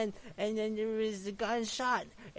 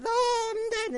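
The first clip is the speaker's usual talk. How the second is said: high, loud hammed-up death scream; mock scream; 14 semitones higher, 7 dB louder, faster than usual. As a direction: shriek it like a cartoon victim dying. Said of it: "she's screaming like".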